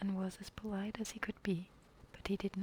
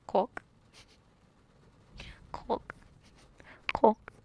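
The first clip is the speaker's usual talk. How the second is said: bird call; 10 dB louder, slower than usual. Pretend. Imitating a bird's call.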